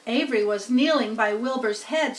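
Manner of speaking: ordinary delivery, nothing marked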